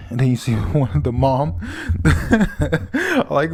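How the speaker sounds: ordinary talk, nothing marked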